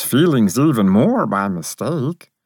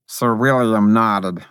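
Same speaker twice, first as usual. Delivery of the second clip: plain reading voice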